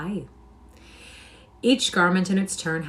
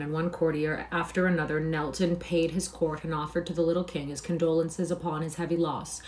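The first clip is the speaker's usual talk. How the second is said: ordinary delivery, nothing marked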